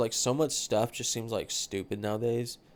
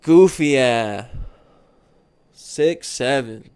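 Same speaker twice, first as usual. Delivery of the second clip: loud meme shout; 11 dB louder, much slower than usual. Acted.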